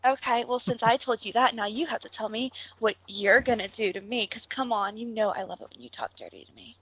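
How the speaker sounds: usual speaking voice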